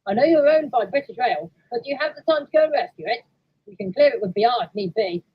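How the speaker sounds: brief the engine on an urgent job; yard foreman. Acted. Foreman asks Clyde to rescue train, businesslike.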